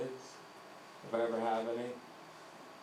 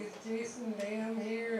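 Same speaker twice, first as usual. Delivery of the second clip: substitute teacher; 9 semitones higher, slower than usual. Retold or imitated. Substitute teacher reads name.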